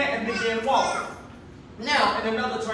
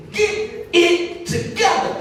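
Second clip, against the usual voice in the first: high, slow, stern scolding, only lightly voiced; the Archbishop's correction; 7 semitones higher, 5 dB louder, slower than usual. Archbishop correcting his young ministers, lightly voiced stern scold. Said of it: "he always said".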